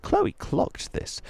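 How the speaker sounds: usual speaking voice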